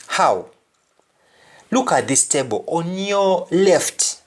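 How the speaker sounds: normal talking voice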